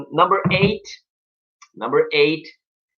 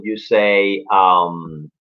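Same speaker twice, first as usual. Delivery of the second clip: normal talking voice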